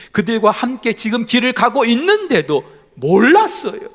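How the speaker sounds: loud, punched 몰랐어요 with a falling end; emphatic. Real, not acted. Preacher drives home that the disciples didn't know, emphatic.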